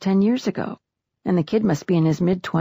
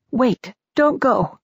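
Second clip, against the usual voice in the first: pleading; 7 semitones higher, slower than usual. A narrator's reading. Widow begs dead husband to stay.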